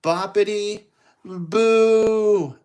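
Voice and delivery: loud magic words, climbing in pitch; spell casting. Acted. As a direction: call out each word, stretching the last one high. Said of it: "And then she goes".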